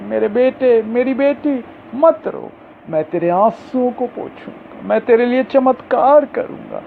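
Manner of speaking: Jesus comforting. Acted. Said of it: "वो कह रहा है".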